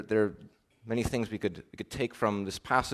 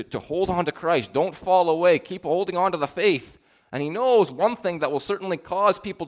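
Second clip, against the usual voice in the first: raised, louder preaching voice, pressing hard; impassioned sermon push, higher and louder; fervent preaching; 6 semitones higher, 4 dB louder than usual. Acted. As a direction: drive the appeal home to the congregation. Preaching with rising intensity.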